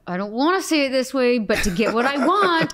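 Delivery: self-persuading inner voice. Retold or imitated. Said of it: "I'm like".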